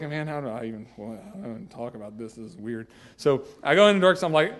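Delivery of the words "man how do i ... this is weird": much softer, almost under his breath; hushed aside. Retold or imitated. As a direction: mumble it to yourself, uneasy. Hesitant aside before telling wife.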